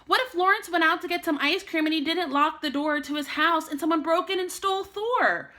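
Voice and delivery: ordinary delivery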